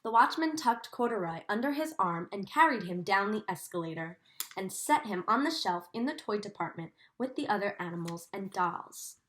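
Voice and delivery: gentle storybook reading for a class; reading to children. A narrator's reading. Teacher reading Corduroy to her class, in a storybook voice. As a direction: read the story to the children, savouring each sight. Teacher reads picture book aloud.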